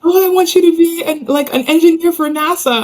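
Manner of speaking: high-pitched hopeful mother impression; eager mom. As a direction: imitate a mom pitching her dream career, voice way up. Mom voiced in a story.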